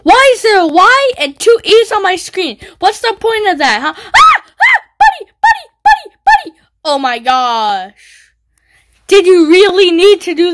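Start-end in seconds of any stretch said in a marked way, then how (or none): 4.0-6.6 high loud repeated yells; panicked yelling. Real, not acted. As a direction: yell his name in alarm. Attacked, yelling at enemy.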